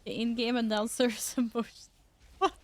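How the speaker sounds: usual speaking voice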